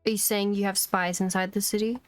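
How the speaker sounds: ordinary talk, nothing marked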